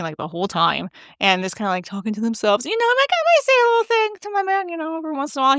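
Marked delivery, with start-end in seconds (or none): 2.6-5.6 high-pitched, quick little aside. Retold or imitated. Saying a little thing to my man, high and quick. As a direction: toss it off high and fast.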